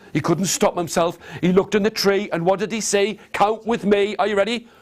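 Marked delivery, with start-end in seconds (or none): none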